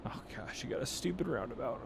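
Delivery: breathy whispered oh gosh. Real, not acted. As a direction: whisper it under your breath. Roundabout surprise while driving.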